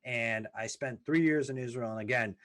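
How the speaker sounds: ordinary talk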